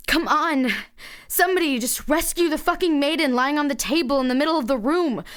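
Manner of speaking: high, loud frustrated yell; exasperated outburst. Acted. Narrator fed up with her useless companions, loud exasperated yell. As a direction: throw it out at a room nobody will act in.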